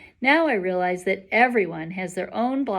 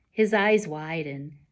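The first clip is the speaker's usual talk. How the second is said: usual speaking voice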